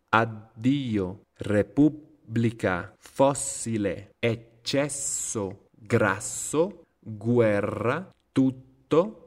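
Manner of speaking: slow word list, each doubled consonant held, as in let-to, tut-to; exaggerated double-consonant pause. Shown as a demonstration. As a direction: stop inside each word, as in piz-za, ad-dio. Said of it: "Noten la, la pausa que hago, un poquito exagerada".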